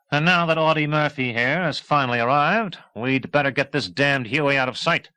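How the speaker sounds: officer snapping orders; gruff authority. A narrator's reading. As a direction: reassert command with a scoff. Murdoch reasserting his authority.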